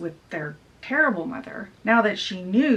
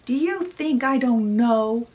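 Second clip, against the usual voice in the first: raised, accusing retold rant; mock screaming; 6 semitones higher, 4 dB louder than usual. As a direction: fire it off as a furious mother. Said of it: "Lady Tremaine is screaming".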